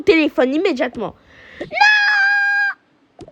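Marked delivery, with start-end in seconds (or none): 1.6-3.3 high piercing no; furious childish scream; shrieking refusal. Acted. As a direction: scream it at your mother. Refusing to hand over phone.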